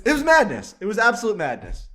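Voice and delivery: high, loud exclamation; emphatic. Real, not acted. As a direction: hammer home the chaos. Emphatic story wrap-up.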